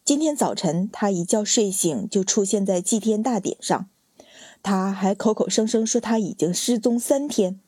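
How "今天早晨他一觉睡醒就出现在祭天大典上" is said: ordinary delivery, nothing marked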